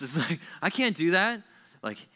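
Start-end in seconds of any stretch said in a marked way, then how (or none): 0.6-1.4 high, loud mock protest; exaggerated child's objection; kid's refusal. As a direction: refuse a chore with hammed-up alarm.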